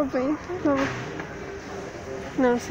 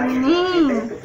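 ordinary talk, nothing marked

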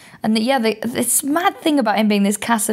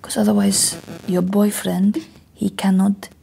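hushed Spanish masseuse, slowing at the end; imitated Spanish accent. Spanish masseuse warning client about boyfriend, imitated Spanish accent. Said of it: "like, this beautiful accent".

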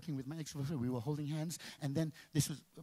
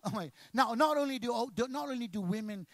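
ordinary delivery

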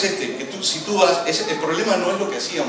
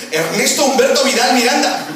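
full name called out louder and higher, laughing through it; a wife scolding by full name, mock-angry; 9 semitones higher, 8 dB louder than usual. Angry wife calling her husband by his full name, loud and laughing. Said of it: "cuando está enojada te dice".

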